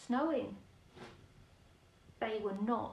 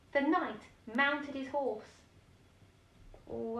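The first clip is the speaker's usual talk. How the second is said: ordinary delivery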